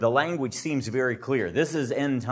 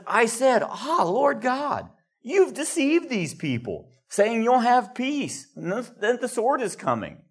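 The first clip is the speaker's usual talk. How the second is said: upset Jeremiah; 9 semitones higher than usual. Retold or imitated. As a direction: plead with God, voice pitched up in distress. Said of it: "he's upset".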